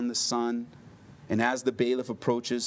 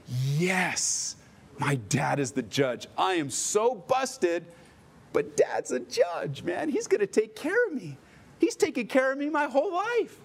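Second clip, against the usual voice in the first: put-on high, giddy relieved kid; relieved teen; 7 semitones higher than usual. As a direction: gush with relief, sure he's safe. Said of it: "he's like".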